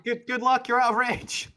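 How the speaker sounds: very high, loud falsetto character; comic parting shout. Acted. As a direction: yell back in a squeaky put-on voice. Character shouts back from afar.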